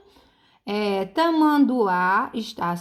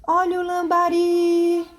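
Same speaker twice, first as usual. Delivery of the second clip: high, sustained hawker's cry; street vendor's call; 7 semitones higher, 3 dB louder than usual. Shown as a demonstration. Teacher selling the word to find its stress, vendor's call. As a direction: sell the word, holding the final RI long. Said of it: "é você vender a palavra".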